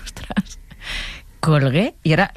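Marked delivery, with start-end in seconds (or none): 0.0-0.6 stunned, barely voiced whisper; stunned reaction. Journalist retelling her shock at the phone call, stunned whisper. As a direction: let it out under your breath.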